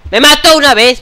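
loud, high exclamation; outraged outburst. Real, not acted. Gamer just killed in the game, loud outraged cry. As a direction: blurt it out in shock.

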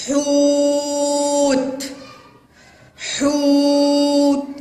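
"حوت" with ū held very long on a high level note; strong long damma. Shown as a demonstration. Teacher checks for madd with waw, drawn-out monotone. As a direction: intone ḥūūūt, stretched out. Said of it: "إنها ضمة قوية وشديدة".

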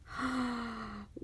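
soft gasp of delight; excited gasp. Real, not acted. Seeing embroidered pieces in the box, excited gasp. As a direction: catch your breath at a lovely surprise. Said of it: "I got a little excited there".

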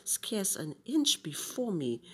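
ordinary delivery, nothing marked

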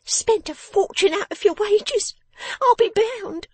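very high, loud, scolding little voice; bossy person of the house. Acted. Miss Wren orders her father to hand over money, bossy and high-pitched. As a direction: order him about in a piercingly high voice.

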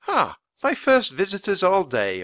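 bright, high chirp of a friendly lady; chirpy. A narrator's reading. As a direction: chirp it out cheerfully. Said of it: "a woman chirped".

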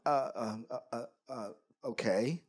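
soft, low stuttering reply; mock fearful hesitation, barely getting the word out; nervous stammer. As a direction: stammer timidly, imitating your scared self. Retold scared stammer before the biker.

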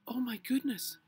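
high, loud flustered cry; shocked exclamation. A narrator's reading. Missus Grumby seeing what had happened, shocked exclamation. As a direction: exclaim in high-pitched dismay. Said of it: "exclaimed Missus Grumby".

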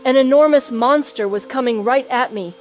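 ordinary delivery, nothing marked

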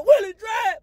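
high shouted name; hype shout. Real, not acted. Hyping himself up, shouting his name high.

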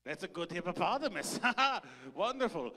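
excited shouted praise; delighted cheer. Acted. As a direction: cheer the kid on with glee. Cheering child's hippo impression.

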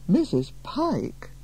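stunned, swooping question; sputtering disbelief. A narrator's reading. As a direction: draw the name out in shock. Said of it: "Miss Fletcher could only sputter".

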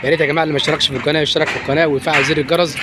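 ordinary talk, nothing marked

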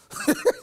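short high laugh; quick laugh at one's own joke. Real, not acted.

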